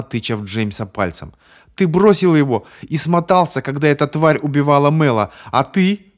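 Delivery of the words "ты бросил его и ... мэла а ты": high, loud accusing shout; screaming accusation. Acted. Bonnie blames James for Mal's death, screaming. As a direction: hurl the blame at him.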